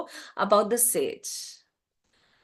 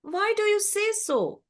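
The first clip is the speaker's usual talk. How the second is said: high-pitched, unhurried puzzlement; puzzled crow; 10 semitones higher than usual. A narrator's reading. Crow asks the unhappy swan, puzzled and high. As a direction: ask in high, mild bewilderment. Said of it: "Now the crow is puzzled".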